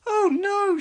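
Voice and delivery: high, loud dismayed cry; alarmed cry. A narrator's reading. Sarah seeing the yellow river, alarmed cry. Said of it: "she cried".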